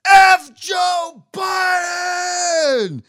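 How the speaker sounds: drawn-out, high-pitched full-throated scream. Retold or imitated. Dude yelling from a parking deck at night, screaming. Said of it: "this dude just screaming".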